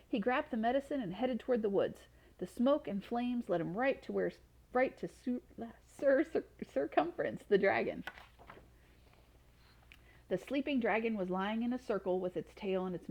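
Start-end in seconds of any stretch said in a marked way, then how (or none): none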